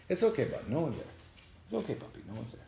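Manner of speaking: high pet talk to soothe; talking to the dog. Real, not acted. Vlogger reassuring her nervous dog, high pet talk. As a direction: reassure the puppy that nothing is there.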